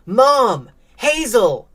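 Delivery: high, loud call for help. A narrator's reading. Trapped boy calls to mom and sister, calling out. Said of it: "he called out".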